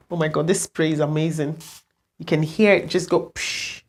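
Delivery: normal talking voice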